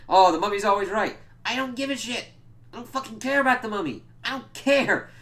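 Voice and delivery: loud, very high-pitched protest; exasperated rant. Acted. Reviewer sick of the mummy plot, loudly exasperated.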